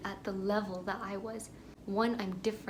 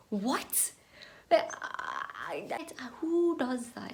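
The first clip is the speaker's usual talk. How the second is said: incredulous; 4 semitones higher, slower than usual. Real, not acted. Baffled by friends keeping tabs, incredulous. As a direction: ask it in stunned outrage.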